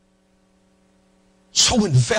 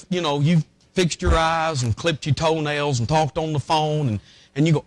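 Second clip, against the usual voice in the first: ordinary talk, nothing marked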